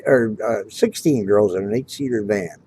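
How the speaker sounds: ordinary delivery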